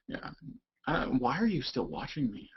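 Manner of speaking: ordinary talk, nothing marked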